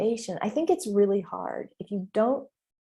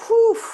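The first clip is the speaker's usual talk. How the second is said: high, loud relieved blow-out; loud whew; 14 semitones higher, 11 dB louder than usual. Real, not acted. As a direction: let out a big relieved whew. Exhale after intense confession.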